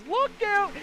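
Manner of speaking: high, loud warning yell; sudden sharp cry of alarm; alarmed shout. Acted. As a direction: yell to warn of the crash. Contact between leaders on track.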